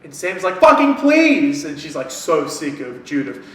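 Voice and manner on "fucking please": high, loud, drawn-out begging; desperate plea. Retold or imitated. Sam begging Clarissa for a final wish, loud desperate plea. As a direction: beg as if at the end of her rope.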